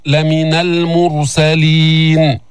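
slow recitation, المرسلين with a thick r; heavy ra. Shown as a demonstration. Teacher corrects learners, المرسلين with heavy ra. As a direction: recite المرسلين with a full dark r. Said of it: "فخم الراء".

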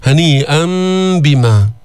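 final n of هنيئا turned into a hummed m before بما; iqlab. Shown as a demonstration. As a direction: chant هنيئا بما as haniʾam-bima. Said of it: "تقلب النون الساكنة ميما عند الباء". (إقلاب نون هنيئا ميما عند باء بما)

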